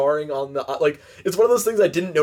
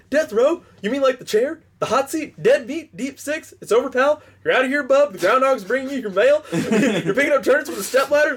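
much higher, goofy rising patter; Ernest's rapid riff; 9 semitones higher than usual. Retold or imitated. Friend performing Ernest's death-row riff, high goofy patter. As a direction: rattle off each slang like a manic Ernest. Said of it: "And he's like".

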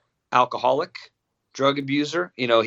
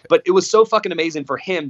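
usual speaking voice

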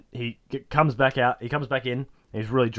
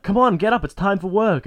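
nagging wake-up; 8 semitones higher, 6 dB louder than usual. Retold or imitated. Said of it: "she's like".

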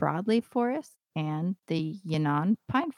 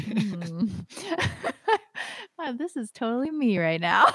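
laughing, caught out, a bit louder; amused self-recognition; 5 semitones higher, 4 dB louder than usual. Retold or imitated. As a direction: chuckle at yourself mid-line. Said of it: "and I'm like".

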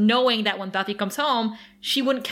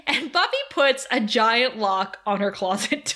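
ordinary delivery

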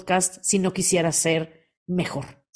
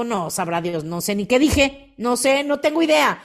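ordinary delivery

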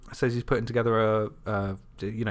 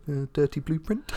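hesitant, higher rising answer; sheepish answer; 4 semitones higher than usual. Acted. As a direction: mumble it, embarrassed by the name. Acts out a reluctant answer.

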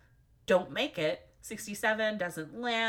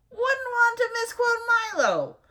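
loud, high, drawn-out mock concern; 12 semitones higher, 9 dB louder than usual. Real, not acted. Reader correcting a misread quote, loud mock concern. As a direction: exaggerate it, pitched way up and loud.

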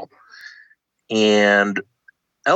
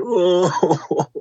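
high imitated weeping moan; a much higher mock weeping oh; mock sob; 9 semitones higher than usual. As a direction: ham up a wail of crying. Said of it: "I hear her sobbing and, like, openly weeping".

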